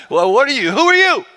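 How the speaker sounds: put-on cocky New York accent, pitch jumping up. Woman talking smack to Jesus, New York accent. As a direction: challenge him in a loud, high New York accent. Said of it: "like, a New York accent".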